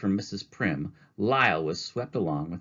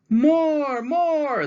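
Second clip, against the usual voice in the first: much higher, loud cheering; crowd calling out; 8 dB louder than usual. Acted. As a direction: beg for an encore. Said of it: "shoppers called out".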